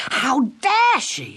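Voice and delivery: high, slow, outraged; outraged girl. Acted. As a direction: spit out the outrage. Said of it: "said Gwendolen".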